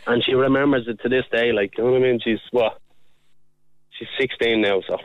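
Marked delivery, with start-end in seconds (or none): none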